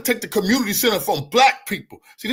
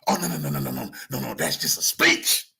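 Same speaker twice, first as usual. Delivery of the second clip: mock dismissive official; 14 semitones lower than usual. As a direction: wave it away in a hushed, mocking voice. Mocking officials brushing off complaints.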